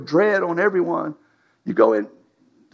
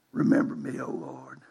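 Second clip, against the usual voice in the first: hushed prayer; 9 dB quieter than usual. Retold or imitated. Samson's prayer voiced.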